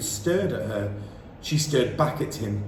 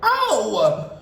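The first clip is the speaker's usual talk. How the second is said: loud, higher yelp of pain; boy yelps in pain; 7 semitones higher, 6 dB louder than usual. A narrator's reading. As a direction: yelp as if stabbed. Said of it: "and he yelled".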